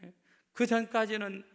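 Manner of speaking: loud, pounding sermon; full-force sermon, 내가 stressed; fiery preaching. Acted. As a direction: drive each line home to the crowd.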